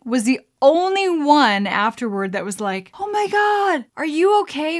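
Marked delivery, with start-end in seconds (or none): 3.0-4.8 high, loud retold cry of concern; alarmed concern. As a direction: imitate a worried friend calling out. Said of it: "they're genuinely concerned for you".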